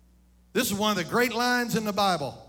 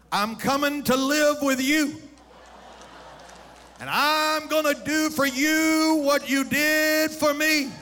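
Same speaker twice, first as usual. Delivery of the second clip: higher, slow, emphatic mock threat; comic preaching build; 6 semitones higher, 4 dB louder than usual. Preacher voicing an old parent warning their children, mock-threatening. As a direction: preach the joke at full voice.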